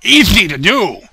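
loud, drawn-out gleeful exclamation; slow and loud, relishing the joke. Real, not acted. Gleeful punchline.

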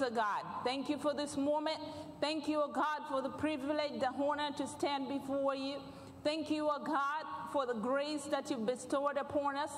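ordinary talk

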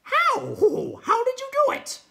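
very high, loud hooting questions; bewildered night hob. Acted. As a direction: hoot the questions out in astonishment.

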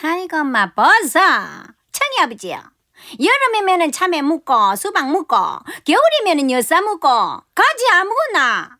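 theatrical dialect rant with high swoops; Ppaengpa in pansori. Acted. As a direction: play a stagey, complaining pansori wife. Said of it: "심청가 중에 왜 뺑파고 신봉사 대목".

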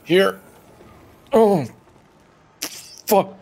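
loud, high cursing at the game; raging gamer. Real, not acted. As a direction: yell curses as you fall. Gamer falling down pit.

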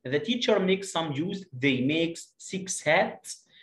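put-on slow, clear reading for children; slow teacher read-aloud; slow model reading. Shown as a demonstration. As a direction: read it out slowly, word by word. Teacher rereads the story slowly.

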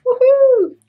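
high, loud ironic woohoo; mock cheer. Vlogger cheers ironically about doing her receipts, high and loud.

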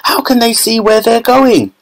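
high frightened shriek. A narrator's reading. Violet in the dark tunnel, shrieking in fright. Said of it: "shrieked Violet Beauregard".

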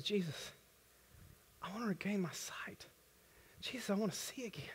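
breathy whisper, pleading; whispered plea. Retold or imitated. Blind man whispering his plea to Jesus. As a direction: whisper it like a confession. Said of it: "very honestly says".

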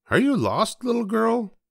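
high, lilting question to a little one; storybook adult talking to a little girl; photographer to a child. A narrator's reading. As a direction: pitch it up as if addressing a small child. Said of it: "asked the photographer".

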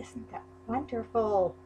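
ordinary talk

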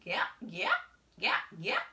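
playful duck calls; duck quack. A narrator's reading. Duck sound in story.

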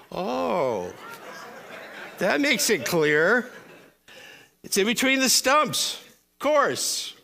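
sarcastic. Acted. Mocking a confusing definition.

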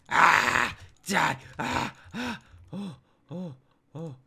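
worked-up yelling in a fight; excited screams. Real, not acted. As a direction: burst of loud battle yells, tapering off. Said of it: "I got excited".

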